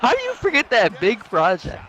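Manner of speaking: high-pitched mock outrage; incredulous. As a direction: squeal it in disbelief. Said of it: "I was like".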